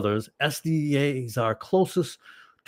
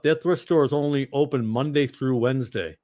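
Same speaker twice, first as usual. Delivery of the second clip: ordinary talk, nothing marked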